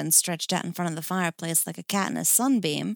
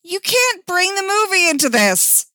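high, loud indignant protest; hammed-up outrage, pitched high; 13 semitones higher, 9 dB louder than usual. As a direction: object at full volume. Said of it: "Dick protested".

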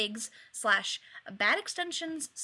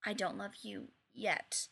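softer, dawning replay; replayed quietly; 3 semitones lower, 7 dB quieter than usual. A narrator's reading. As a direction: hear it back, quietly realizing. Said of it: "rewinds and fast forwards".

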